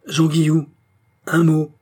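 appel sec, bien plus fort et plus aigu; arrêter l'ennemi d'une voix qui claque; interpellation forte. A narrator's reading. Pierre interpelle son ennemi la nuit. (fils en colère interpellant le meurtrier de sa mère)

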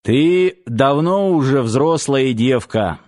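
ordinary delivery, nothing marked

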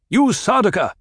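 high, loud challenge to the group; calling out. A narrator's reading. Paul calling out the cornered Sardaukar, loud. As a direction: call them out across the cave. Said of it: "Paul called the remaining group".

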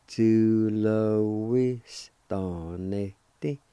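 Tu lo ves tan este drawn out very slowly; very slow pronunciation. Shown as a demonstration. Teacher modelling the whole word for students, very slowly. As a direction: stretch each syllable so learners catch it. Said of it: "It's pronounced like this".

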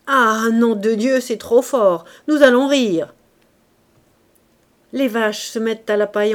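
loud, menacing roar of a brutal father; drunken growling fury. A narrator's reading. Drunk father threatening his sick daughter in bed, furious shout. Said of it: "Il décrocha le grand fouet en grognant".

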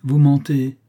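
loud accusing snap; angry retort. A narrator's reading. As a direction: throw the lie back at him. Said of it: "répartit le chef".

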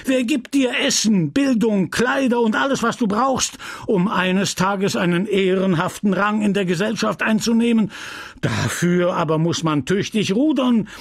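apothecary scolding his ungrateful apprentice; panting rant. Acted. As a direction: pant out the reproaches. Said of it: "redete weiter in hechelnden Sätzen".

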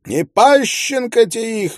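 high squealing peasant shriek; squealing milkmaid. A narrator's reading. Milkmaid screams abuse about the child, high squeal. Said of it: "визжит скотница".

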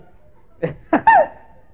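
loud, high burst of laughter; high shrieking laugh. Real, not acted. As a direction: cackle at the joke. Laugh continues. (carcajada aguda)

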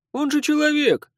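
high, loud shout. Acted. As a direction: yell it out in disbelief. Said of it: "крикнул кто-то".

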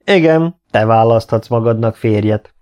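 emphatic confirmation. A narrator's reading. King confirms to the surprised Nikolá, loudly and firmly. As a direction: confirm it for all to hear. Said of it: "erősítette meg".

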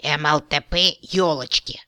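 forced out with disgust. A narrator's reading. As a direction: squeeze the words out in revulsion. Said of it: "с нескрываемым отвращением выдавил из себя".

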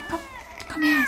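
high sing-song cat call; calling a cat over in a high coaxing voice. Acted.

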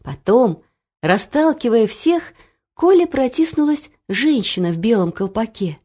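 ordinary delivery

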